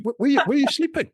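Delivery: imitated questioning local. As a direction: ask it high, as a retold stranger would. Imitating foreign locals asking a traveller.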